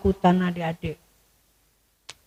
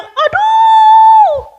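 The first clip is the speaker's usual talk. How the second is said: very high, loud wail of despair; anguished cry; 10 dB louder than usual. A narrator's reading. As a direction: cry out in shock. (teriakan putus asa, sangat tinggi dan keras)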